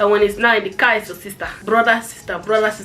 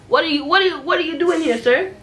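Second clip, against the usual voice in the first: mocking cashier; 6 semitones higher than usual. Mocking cashier scolding customer.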